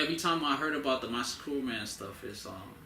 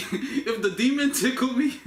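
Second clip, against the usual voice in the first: laughing; 9 semitones higher, 4 dB louder than usual. Real, not acted. Laughing at own tickle joke.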